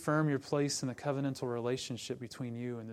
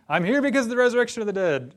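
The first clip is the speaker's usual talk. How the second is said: higher, louder and fast; triumphant declaration; 7 semitones higher, 10 dB louder, faster than usual. Retold or imitated. Paul before Pharisees and Sadducees, triumphant. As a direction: fire it off like a winning card. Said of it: "And he says".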